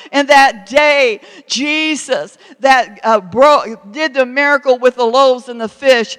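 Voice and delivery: loud, driving sermon delivery; preaching at full force. Acted. Preacher urging the congregation, loud and driving.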